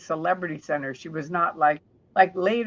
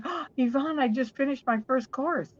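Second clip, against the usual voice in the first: put-on high, eager young voice; imitating a beaming young man; 7 semitones higher than usual. Young man bursting to tell Yvonne his news, high and eager. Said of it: "he went".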